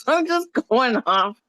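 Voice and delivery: laughing through the words; sheepish laugh while apologizing. Real, not acted. Embarrassed apology, laughing mid-sentence.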